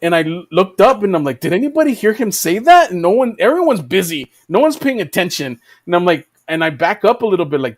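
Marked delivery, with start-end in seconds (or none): 1.4-2.9 high, startled question; alarmed. Retold or imitated. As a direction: ask in disbelief.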